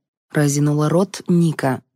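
usual speaking voice

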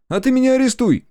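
very high, loud jeering dare; mocking taunt. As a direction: goad the cop into it. Kolyan taunts the policeman. (дразнит, очень высоко и громко)

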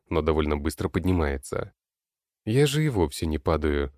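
plain reading voice

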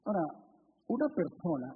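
ordinary talk, nothing marked

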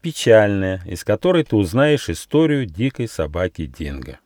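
ordinary delivery, nothing marked

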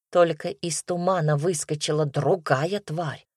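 ordinary talk

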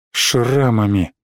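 "шрамами" low, slow and falling; brooding echo. A narrator's reading. Narrator echoing the scars on the board, "шрамами" said broodingly. Word echoed alone as a brooding thought.

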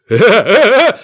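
sudden high panicked cry; terrified yelp. Acted. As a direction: yelp in terror. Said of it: "В ужасе заорал полицейский". (испуганный вскрик)

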